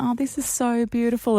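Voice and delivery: breathy, swooning gush at the view; mock swoon over the view; gushing delight. Girlfriend gushing at the view, waiting for the proposal, mock swoon.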